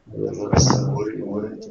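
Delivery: delayed echo of the previous word; words echoing back, slowed. Real, not acted. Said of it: "Все ще є таке ехо".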